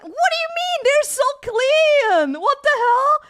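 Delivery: very high, loud squawk of disbelief; outraged protest. Real, not acted. Streamer answers dirty-fingernail comment, outraged. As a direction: protest loudly, stretching the key word.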